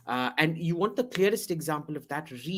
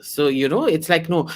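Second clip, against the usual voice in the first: normal talking voice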